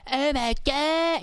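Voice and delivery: put-on high, drawn-out toddler wonder; exaggerated slow squeaky kid amazement; amazed little kids. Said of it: "even just everyday life, they're just like".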